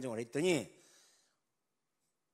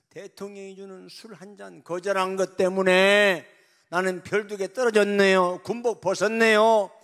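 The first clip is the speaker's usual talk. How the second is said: higher, building to a loud sobbing shout; anguished wail; 8 semitones higher, 17 dB louder than usual. Acted. General wailing to God, building to a shout. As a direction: cry out to God in grief.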